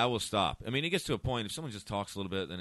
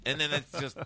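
usual speaking voice

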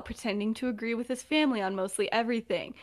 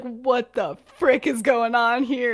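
slightly raised, drawn-out cursing; mock loud swearing; 4 dB louder, slower than usual. Friend retelling his shock at the inheritance, mock loud swearing. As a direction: swear slowly, only moderately loud. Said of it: "starts to swear very loudly".

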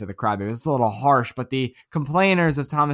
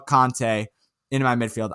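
ordinary talk

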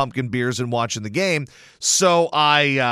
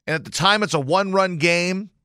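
ordinary talk

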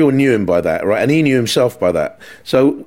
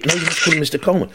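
higher, quick mock correction; pompous producer; 6 semitones higher, faster than usual. Producer insisting on the manager's title, pompous. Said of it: "producer was a little bit pompous".

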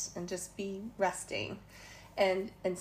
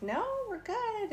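chirpy high reassurance; 13 semitones higher than usual. Retold or imitated. Cheerful reply to preacher.

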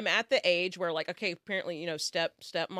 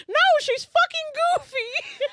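high, slow goofy cartoon voice; dopey cartoon dog voice; 11 dB louder, slower than usual. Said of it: "he says".

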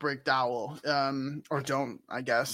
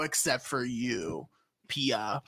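slow, low, softer put-down; pointed exclusion; 6 semitones lower, 8 dB quieter, slower than usual. Acted. Villagers bar Pia from entering, low and pointed. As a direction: drop low and single one out. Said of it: "And they're like".

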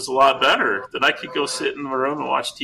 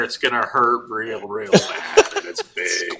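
teasing dad; slower than usual. Retold or imitated. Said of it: "He says".

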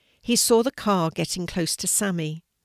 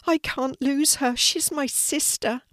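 high-pitched, distressed plea; tearful; 7 semitones higher than usual. A narrator's reading. Sidney to the hedgehog about his sister, tearful distress. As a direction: plead through tears, understated. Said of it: "as the tears spilled down his face".

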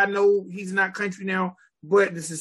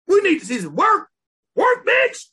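pitched-up hype yell; loud hype shout; 10 semitones higher, 5 dB louder than usual. Acted. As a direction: call it out loud. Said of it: "Don't say that".

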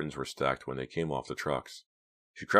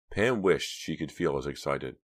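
ordinary delivery, nothing marked

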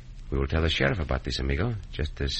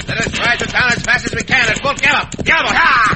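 high, loud shouted order; rally him to ride hard; shouting; 10 dB louder than usual. Acted. Cisco telling Pancho to ride to town at full gallop, loud shout.

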